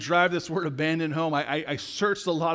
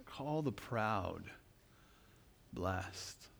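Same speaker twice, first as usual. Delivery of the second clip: quieter and slower, dwell on Call and blessed; slow, hushed repeat; 6 semitones lower, 11 dB quieter, much slower than usual. Shown as a demonstration. Pastor repeats the verse so the church can ponder it, hushed and slow. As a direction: let the phrase hang with a pause before blessed. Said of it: "Just chew on that for a moment".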